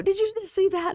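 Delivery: high-pitched incredulous imitation; hammed-up, squeaky-high disbelief; mock outrage. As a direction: shoot the pitch way up as if pausing the film in disbelief. Acts out pausing film to object.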